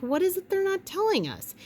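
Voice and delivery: high suspicious questioning voice. Retold or imitated. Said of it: "I'm like".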